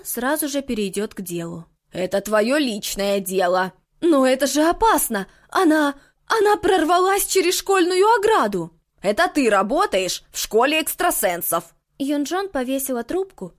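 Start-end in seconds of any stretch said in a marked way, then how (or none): 1.9-3.7 stern witch voice. Acted. Witch snaps at caller. 4.0-8.7 panicked plea. Acted. 9.0-11.7 stern witch voice. Acted. Witch retorts angrily.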